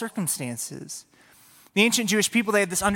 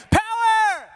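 demonstrated shout; slower than usual. Shown as a demonstration. Leader getting kids to shout back, high full shout. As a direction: scream it for a crowd to echo. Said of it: "So we shout".

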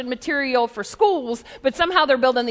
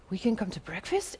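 timid disbelief; 6 semitones lower, 12 dB quieter than usual. Retold or imitated. Pastors amazed at being invited.

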